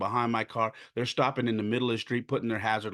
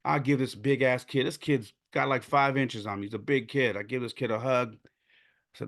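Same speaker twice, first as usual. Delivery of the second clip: ordinary talk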